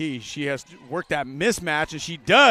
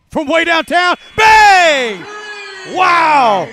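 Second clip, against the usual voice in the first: much higher and louder, stretching the name out slowly; screaming call; 13 semitones higher, 16 dB louder, much slower than usual. Real, not acted. Calling a buzzer-beating three, full-voiced scream. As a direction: scream it like the buzzer-beater just dropped.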